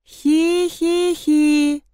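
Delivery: high, slow monotone "hee-hee-hee"; eerie toy giggle. A narrator's reading. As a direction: giggle like a spooky toy, drawn out. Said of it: "Тихо, но отчётливо прошелестело". (жуткое протяжное хихиканье)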